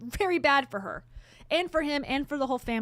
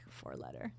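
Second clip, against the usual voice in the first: whisper; 18 dB quieter than usual. Pretend. Whispered aside after jab.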